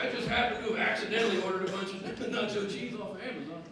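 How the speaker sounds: ordinary delivery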